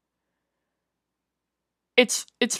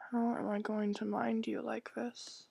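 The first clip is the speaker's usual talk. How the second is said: whispered; 14 dB quieter than usual. Acted. Mother sighs over daughter.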